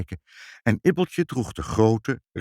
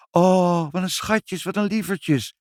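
put-on high, cooing child's delight; delighted exclaiming; 11 semitones higher, 4 dB louder than usual. A narrator's reading. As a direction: gush over the kittens in a high voice. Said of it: "riep Ibbeltje". (verrukt, hoog kinderstemmetje)

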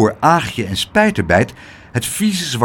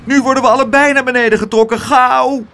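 high, loud, fast panicked cry; rushed, high-pitched desperation; desperate groaning; 11 semitones higher, 5 dB louder, faster than usual. A narrator's reading. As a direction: plead for rescue as time runs out. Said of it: "kreunde Spijterbijt".

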